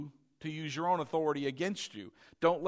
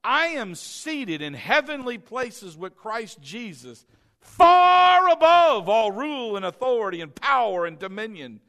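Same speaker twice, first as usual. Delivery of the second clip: triumphant preaching; 4 semitones higher, 10 dB louder than usual. Acted. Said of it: "That's not thinking".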